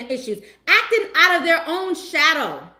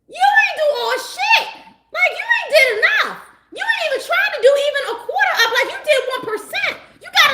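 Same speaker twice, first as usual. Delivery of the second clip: exasperated shout; 12 semitones higher, 4 dB louder, faster than usual. Real, not acted. Calling out the breadcrumbing ex.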